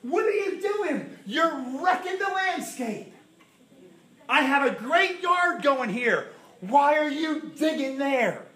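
high, slow alarmed outcry; panicked protest. Retold or imitated. Believers protesting to the Lord about the dug-up yard, high panicked outcry. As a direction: cry out in panic at the damage. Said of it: "we start saying".

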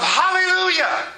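high-pitched jubilant cry; imitated shout of praise. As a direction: cry out like a people just delivered. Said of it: "began to shout the praises of".

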